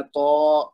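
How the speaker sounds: "تو" as a long, backed "taw"; lengthened vowel demo. Shown as a demonstration. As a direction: hold the vowel of تو for two beats. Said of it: "دو حرکت تک کھینچنا ہے".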